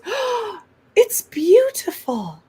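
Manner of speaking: high, slow, gushing celebrity voice; light impression of a singer's delight. [name]'s reaction voiced in story.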